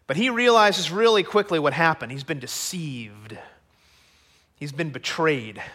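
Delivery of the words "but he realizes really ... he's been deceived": normal talking voice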